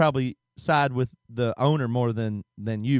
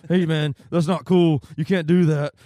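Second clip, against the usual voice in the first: slow, a touch louder, lightly voiced upset woman; nervous neighbor; 6 dB louder than usual. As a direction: scold him but hesitantly. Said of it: "And she goes".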